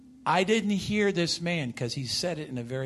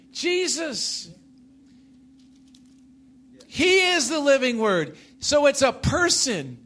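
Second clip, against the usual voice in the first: slow, louder, raised preacher's voice; full-force preaching; 6 semitones higher, 8 dB louder, slower than usual. Real, not acted. Preacher teaching a congregation on the living Word, full-force preaching. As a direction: proclaim it, each phrase driven home.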